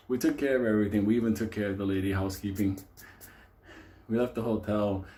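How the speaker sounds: usual speaking voice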